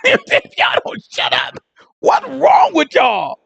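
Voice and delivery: high, slow, exasperated yell at the room; scolding shout. Real, not acted. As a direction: shout them down, fed up. Scolding the laughing audience.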